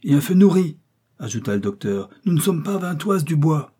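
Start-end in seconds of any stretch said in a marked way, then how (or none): 0.0-0.8 high, loud exhortation; urgent order. A narrator's reading. Doctor orders heavy fire on the elephant, urgent call. 2.2-3.8 high-pitched, pressing alarm; urgent warning. A narrator's reading. Doctor warns the hunters the wood is close, urgent warning.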